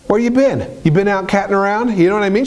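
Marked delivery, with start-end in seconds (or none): 0.1-0.8 same question pushed higher, more insistent; angry wife repeating. Retold or imitated. Wife grilling her husband at the door, higher and insistent. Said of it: "she's like". 0.8-2.0 mock angry wife. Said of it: "she's like".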